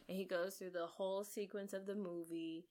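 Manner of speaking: usual speaking voice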